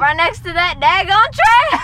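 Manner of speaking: put-on Southern accent. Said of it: "Southern accent".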